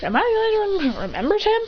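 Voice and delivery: high-pitched exasperated question; acted disbelief. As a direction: ask it high, incredulous. Character exclaims in disbelief.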